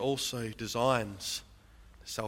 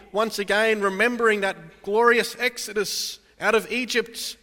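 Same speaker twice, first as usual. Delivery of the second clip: louder, driving pulpit delivery; impassioned sermon voice; full-force preaching; 6 semitones higher, 8 dB louder than usual. Real, not acted. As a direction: preach it out over the congregation. Preaching the Lord's call.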